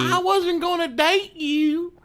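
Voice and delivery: very high, slow, whiny Southern belle imitation; mocking Whitley's voice. Co-host mocking Whitley's annoying voice, high whiny impression. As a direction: mimic her annoying high whine, drawn out. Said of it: "Her southern accent".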